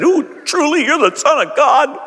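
high, slow reverent awe; awed disciples. Pretend. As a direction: breathe it out in wonder. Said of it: "worshiped Jesus and said".

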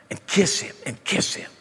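whispered kiss him and kiss him; hushed tender repeat. Real, not acted. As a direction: let it fall to a whisper. Sermon climax, tender repetition.

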